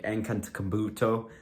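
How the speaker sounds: "Antetokounmpo" garbled as "an-kun-tuh-kum-BOO-to"; mispronounced surname. Shown as a demonstration. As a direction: botch the middle syllables of "Antetokounmpo". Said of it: "like, mispronounce his last name".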